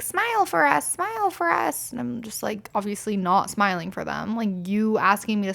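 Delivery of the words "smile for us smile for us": high mock catcaller chant; a hammed-up sleazy repeat. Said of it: "And they're like".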